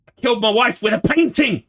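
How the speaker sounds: a streamer hams up a spoken prediction with a mock confession shout; mock confession yell.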